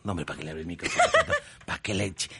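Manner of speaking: fast, laughing "no, hombre"; rapid laughing exasperation; laughing disbelief. Real, not acted. Laughing at guest's answer.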